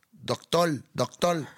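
Doctor said doctol, the final r turned to l. Shown as a demonstration. Showing the Puerto Rican R, Doctor said doctol. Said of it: "la R antes de una consonante".

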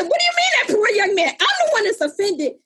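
put-on high, indignant teen protest; indignant retort. Daughter protesting to mother, high indignant retort. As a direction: protest like a wronged teenager.

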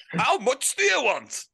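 lightly voiced Yorkshire manager, chuckling; impression of Greg Abbott. Manager on a prank call to a footballer, mock gruff. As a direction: play the gruff manager on the phone.

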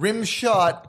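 high-pitched repeated cry; acted movie-quote exclamation; exclaimed correction. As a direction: call it out high, hammed up. Repeats the exclaimed film line.

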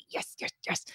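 hushed, unvoiced little cheer; whispered glee. Washing lover celebrates a running machine, whispered glee. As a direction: whisper it like a private victory.

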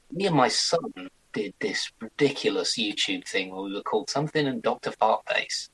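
ordinary talk

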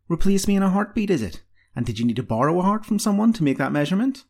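high, sly comeback; mock-questioning, pleased with the jibe; sly insult. A narrator's reading. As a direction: throw it back as a cheeky retort. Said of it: "smiled slyly, enjoying the inventiveness of her own insult".

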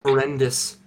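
ordinary talk, nothing marked

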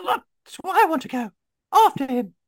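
very high-pitched little adventurer; high character voice. Acted. Adventurer setting off after the frog person, high character call.

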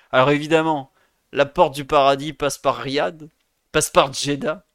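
excited. Real, not acted. Gleeful send-off to Saudi Arabia.

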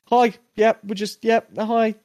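lightly voiced too-nice agents; perfectly polite feds. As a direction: put on a cheery pitch.

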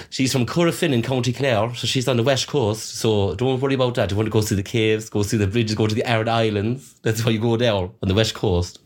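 nana's lilting County Clare brogue; sing-song west of Ireland Clare accent. Shown as a demonstration. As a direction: talk like an Irish nana from Clare. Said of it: "so she talks like this".

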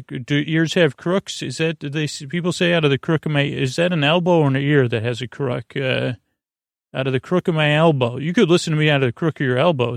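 ordinary delivery

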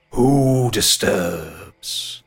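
booming tree spirit, drawn out; booming ancient tree. Acted. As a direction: rumble it like an ancient oak waking. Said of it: "you feel the booming bass in your chest".

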